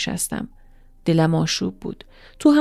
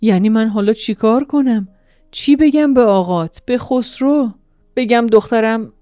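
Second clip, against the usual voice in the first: hoarse voice; 4 semitones higher, 6 dB louder than usual. Acted. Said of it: "با صدای گرفته گفت".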